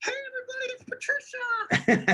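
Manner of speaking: very high greeting breaking into giggles; laughing Patricia voice. Acted. Replaying the Patricia greeting, laughing through it. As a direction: try to hold the voice but crack up. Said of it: "this is going to be for Patricia".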